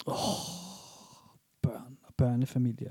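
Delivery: soft whispered sigh at families. Retold or imitated. Podcaster voices own thought about families in whisper. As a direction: breathe it out wistfully, almost unvoiced. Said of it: "Var det nedladende, eller var det sådan, "Åh"?".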